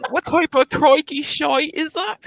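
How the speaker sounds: high, slow, hammed-up British; stretched British outrage through laughter; British accent. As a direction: exclaim in exaggerated mock British. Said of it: "have a conversation in British accent".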